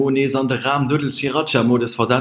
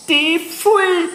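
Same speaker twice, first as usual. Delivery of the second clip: high squeaky jeer; shrill mocking cry; 5 dB louder than usual. Timo laughs scornfully.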